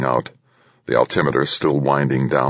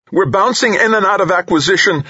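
very high, louder strained shout; 5 dB louder than usual. A narrator's reading. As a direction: shout the report in alarm, high-voiced. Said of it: "Nakamura shouted".

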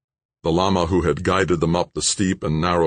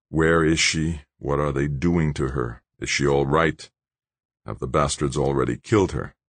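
usual speaking voice

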